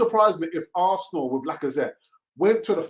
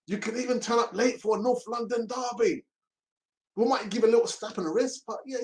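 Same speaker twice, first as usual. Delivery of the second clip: mock pleading club; 7 semitones higher than usual. Mocking club begging Auba to stay.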